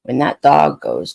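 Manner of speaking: ordinary talk, nothing marked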